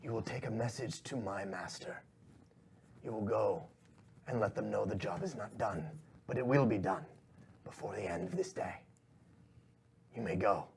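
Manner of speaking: hushed villain command, with pauses; commanding shadowy boss. Acted. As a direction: give quiet, measured orders to a servant. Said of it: "he goes".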